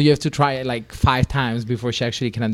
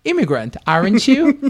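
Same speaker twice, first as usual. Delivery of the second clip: mock Siri voice; 10 semitones higher, 5 dB louder than usual. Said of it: "she was like".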